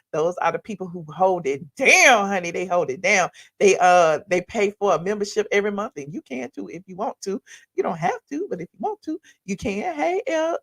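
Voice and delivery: usual speaking voice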